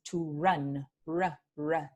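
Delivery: English r. Shown as a demonstration. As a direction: run with an English approximant r. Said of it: "como el inglés".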